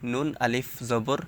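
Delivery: normal talking voice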